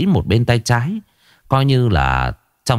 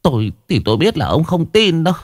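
higher, anxious and insistent; worried wife; 8 semitones higher, faster than usual. Acted. Wife at the table urges her husband that the house is cursed, higher and anxious. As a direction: press your husband, voice lifted by worry.

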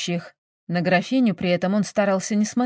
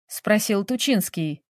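usual speaking voice

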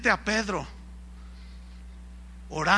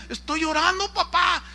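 protesting son; 8 semitones higher than usual. Retold or imitated. Son protests to angry father.

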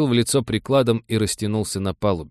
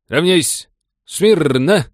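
barked parade-ground commands, higher and louder; shouting drill sergeant; 9 semitones higher, 7 dB louder, much slower than usual. A narrator's reading. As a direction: bellow the commands across the hangar. Commander calls the battalion to attention.

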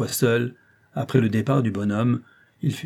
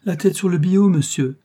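higher, louder fervent cry of loyalty; exclaiming; 8 semitones higher, 6 dB louder than usual. Acted. Concierge swears loyalty to the old clerk, crying out higher and louder. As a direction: swear it on your head, crying out. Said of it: "s'écria-t-elle".

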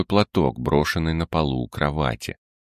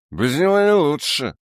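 put-on whining drunk tailor; 11 semitones higher than usual. Tailor voiced high.

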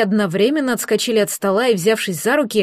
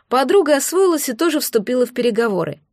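ordinary delivery

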